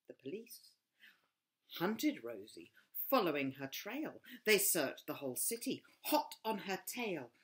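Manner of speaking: ordinary delivery, nothing marked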